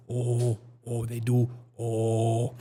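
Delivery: drawn-out Korean-style "oh"; mock Korean accent. Showing how Koreans say it, imitated Korean accent. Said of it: "and Koreans say".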